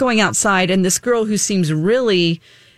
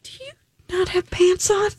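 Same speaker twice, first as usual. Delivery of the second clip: incredulous question; 9 semitones higher, 4 dB quieter than usual. Retold or imitated. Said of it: "I'm like".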